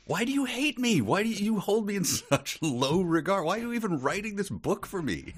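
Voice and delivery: high, whiny complaint; mock whiny outrage. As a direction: wail it like a wounded reader.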